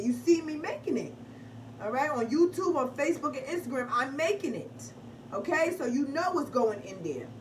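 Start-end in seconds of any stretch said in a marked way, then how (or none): none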